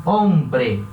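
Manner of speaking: hombre said as ombre, no h; silent h. Shown as a demonstration. Teacher showing the silent Spanish h. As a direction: hombre with the h dropped. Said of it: "ela sempre vai ser muda".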